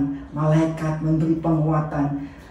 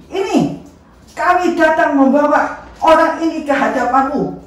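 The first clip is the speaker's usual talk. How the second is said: much higher, loud presenting; high, raised crowd voice presenting the prisoner; crowd speaking up; 8 semitones higher, 5 dB louder than usual. Acted. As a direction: push the prisoner forward with your voice.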